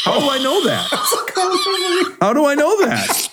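high laughing disbelief, said twice; cracking up at his own trivia. Real, not acted. Laughing at his own odd memory.

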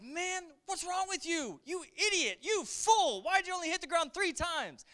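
mad prophet. Retold or imitated. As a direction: play Elisha scolding the king as a fool. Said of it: "And Elisha gets mad".

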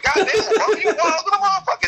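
squeaky voice cracking with laughter; high giggling mock praise; a laughing falsetto quote. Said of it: "be like".

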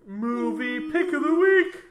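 high, slow, booming trailer announcer; movie trailer voice. Pretend. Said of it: "trying to do the movie trailer voice guy".

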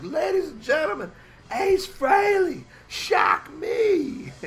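booming stadium announcer, drawn out; announcer shout. Retold or imitated. Announcing Ace to guest.

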